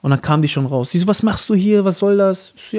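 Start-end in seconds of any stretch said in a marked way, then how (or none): 1.0-2.5 higher-pitched mock challenge; retold confrontation. Girlfriend comes out and confronts him, retold challenge. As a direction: imitate a girlfriend demanding an explanation.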